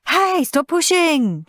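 high, drawn-out yell; high, indignant child's shout; shouted protest. A narrator's reading. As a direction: shout at a queue-jumper. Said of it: "shouted Dizzy Dave".

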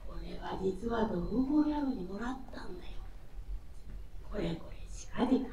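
hushed confiding whisper. Acted. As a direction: lean in and whisper it. Master confides a secret.

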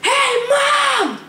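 high, loud shout across the house; calling out. Acted. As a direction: yell for mom from the kitchen. Calling out to mom.

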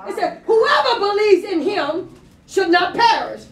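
ordinary talk, nothing marked

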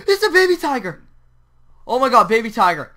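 high, loud thrilled cry; loud outburst of discovery; excited shout. Real, not acted. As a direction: exclaim it in amazed excitement. Spots a baby tiger mid-game.

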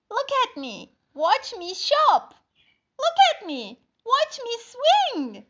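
excited spider. Acted. Happy spider shows off.

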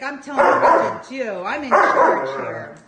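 loud, bossy voicing of the dog. Puppy voiced bossing the other dog, loud. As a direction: play the bossy pup, loud. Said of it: "She's like".

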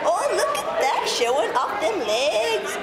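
high, slow playful teasing; sing-song tease of a friend showing off her outfit. Real, not acted. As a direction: tease her about her legs.

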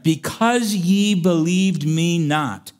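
slow, weighty words of judgement; solemn pronouncement. Acted. God telling Moses why he can't enter, slow and solemn. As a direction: pronounce it as God's verdict. God's verdict quoted from scripture.

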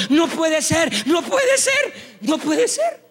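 high mock cries of amazement; doctors' disbelief. Doctors seeing the restored fingers, high hammed-up disbelief. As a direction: climb into a squeaky, comic incredulity. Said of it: "los médicos son los que están diciendo".

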